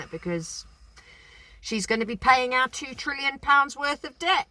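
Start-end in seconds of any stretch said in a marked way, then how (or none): 1.6-4.5 high, loud mock declaiming; retold loud protest. Woman retelling her outburst about the child, loud protest. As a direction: call it out in a raised, high voice.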